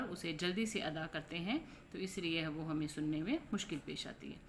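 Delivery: normal talking voice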